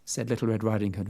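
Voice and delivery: plain reading voice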